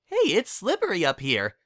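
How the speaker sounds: high pony voice. Acted. Pinkie in chimney.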